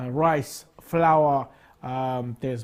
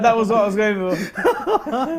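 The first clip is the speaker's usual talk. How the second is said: laughing, higher and louder; 6 semitones higher, 5 dB louder, much slower than usual. Real, not acted. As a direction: laugh as you say it. Laughing reply to beard joke.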